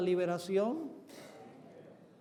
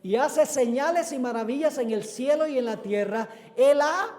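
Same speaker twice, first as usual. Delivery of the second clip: loud, slow proclamation with stretched key words; preaching at full force; 4 semitones higher, 7 dB louder, slower than usual. Acted. Preacher proclaims God's rescue to the congregation, preaching at full force. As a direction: proclaim the verse boldly, landing hard on each phrase.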